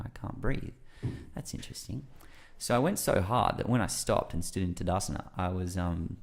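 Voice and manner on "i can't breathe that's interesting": soft detached whisper at the end; calm detached. Retold or imitated. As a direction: observe it calmly. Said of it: "You know".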